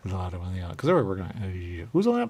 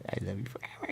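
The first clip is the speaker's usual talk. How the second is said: whispered dog love; 5 dB quieter than usual. Acted. Voicing dog smitten with jerky.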